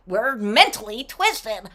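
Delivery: normal talking voice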